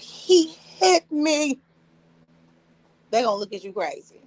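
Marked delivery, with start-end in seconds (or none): 0.0-1.6 slow, high, drawn-out pleading; mock victim plea. A wife telling people her husband hit her, mock pleading. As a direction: stretch it out like a wronged lady. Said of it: "you're just like".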